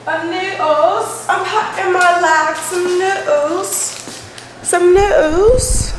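high, slow, drawn-out goofy voice; silly stretched voice. Pretend.

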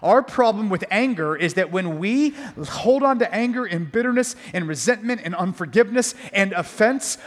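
usual speaking voice

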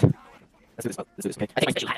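hushed whisper while sneaking. Real, not acted. Gamer sneaking up on enemies, whispering. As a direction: breathe it out unvoiced, sneaking.

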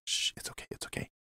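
soothing whisper to the mic; shushing whisper. Pretend. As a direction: hush it softly, like calming a pet. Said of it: "I was talking to the microphone".

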